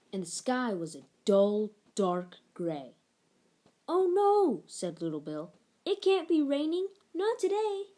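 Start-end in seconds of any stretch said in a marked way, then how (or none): 3.9-7.8 put-on high, dismayed child voice; a small boy groaning at the rain; dismayed little boy. A narrator's reading.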